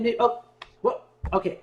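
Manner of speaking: usual speaking voice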